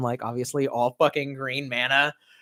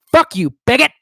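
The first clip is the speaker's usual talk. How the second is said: mock outrage; 8 semitones higher, 7 dB louder than usual. Mock offended outburst.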